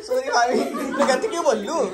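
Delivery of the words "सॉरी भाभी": "सॉरी भाभी" squeaked much higher, teasing; mock high-pitched sorry. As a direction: mimic her sorry in a high mocking voice. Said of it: "इसको बोलना sorry बोल". (बहन की माफ़ी की ऊँची आवाज़ में नकल)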